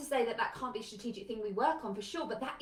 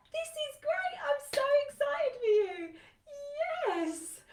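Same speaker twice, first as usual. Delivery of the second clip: much higher, bright forced enthusiasm with a tearful crack; mock excited cheer; 4 dB louder, slower than usual. Parent to child moving to Australia, forced over-the-top cheer. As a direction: gush over the news while hiding the hurt.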